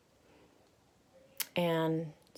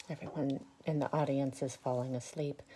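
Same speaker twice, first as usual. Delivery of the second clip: ordinary delivery, nothing marked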